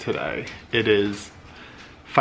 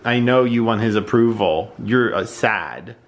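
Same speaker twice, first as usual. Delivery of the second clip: usual speaking voice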